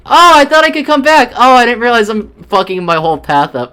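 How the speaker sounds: high, loud panicked shout; panicked yell. Real, not acted. Gamer yelling in dismay at his ruined path.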